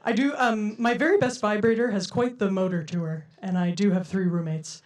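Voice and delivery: usual speaking voice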